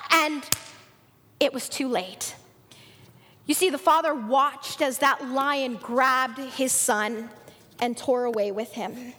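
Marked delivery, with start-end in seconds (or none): none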